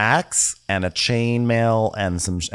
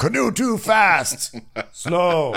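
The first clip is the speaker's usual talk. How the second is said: high, drawn-out mock cry; mock caveman Mike; 11 semitones higher, 3 dB louder, much slower than usual. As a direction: call it out slowly in broken speech. Joking as useless Mike.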